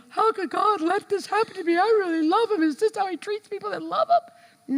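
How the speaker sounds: high, whining self-pity; high whimpering complaint; whiny complaint. Retold or imitated. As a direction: whine like a hurt child. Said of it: "rather than".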